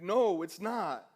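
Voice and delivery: loud, drawn-out mock protest; loud acted refusal, stretched out; protesting back. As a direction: slowly wail the denial at full volume. Exhausted storyteller protests to coach.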